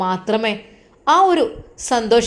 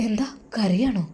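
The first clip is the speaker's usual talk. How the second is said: whisper; 2 semitones lower, 6 dB quieter than usual. Acted. Husband whispers at night.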